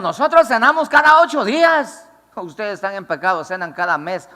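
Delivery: mucho más agudo y fuerte, jactancioso; burla presumida. Retold or imitated. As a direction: imita al que se golpea el pecho. Imita a creyentes presumidos. (burla jactanciosa aguda)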